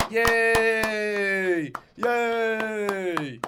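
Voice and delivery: loud, high, long-held yays; mock cheering. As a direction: hold each cheer out like an overdone crowd. Mock applause for Sergio.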